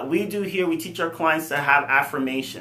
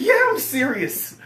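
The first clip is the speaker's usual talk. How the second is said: much higher, slowed insistent answer; high mock insistence; 12 semitones higher, slower than usual. Insisting to his brother, high slowed mock seriousness. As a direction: drag it out, pretending to be earnest.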